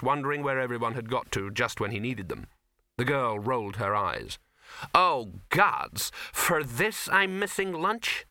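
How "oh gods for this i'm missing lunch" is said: louder oh gods, then high, slow indignant question; exasperated. Acted. The actress hearing Silverfish bleat, exasperated and louder. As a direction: roll your eyes with your voice. Girl rolls her eyes.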